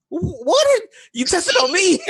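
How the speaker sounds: high, startled disbelief; mock-outraged Surgeon General caught off guard; shocked squeal. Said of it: "He's like".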